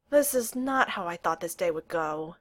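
plain reading voice

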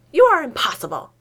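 indignant exasperation; exasperated. Acted. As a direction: snap at an infuriating colleague. Exasperated outburst at rival.

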